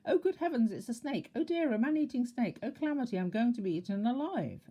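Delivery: high, loud panicky wailing; terrified panic. A narrator's reading. Mister Jelly thinking the worm is a snake, high panicky wail. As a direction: squeal in terror.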